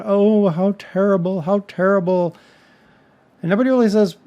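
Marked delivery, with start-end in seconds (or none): 0.0-2.3 mock hand-wringing. Commentator mocking the talk after the shooting, mock hand-wringing. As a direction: imitate people fretting over tragedy. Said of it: "all the hand-wringing about".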